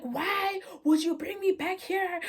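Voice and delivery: high, slow mock wail; retold crashing out. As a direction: imitate an anguished, drawn-out plea. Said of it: "She's like".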